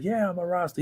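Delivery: high, soft imitated Jamaican accent. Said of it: "He could have the accent and everything, Jamaican accent".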